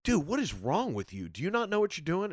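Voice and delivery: retold comics berating a colleague; laying into someone. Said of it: "laying into me like".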